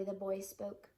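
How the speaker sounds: plain reading voice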